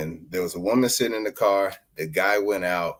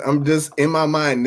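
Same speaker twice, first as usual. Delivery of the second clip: ordinary talk, nothing marked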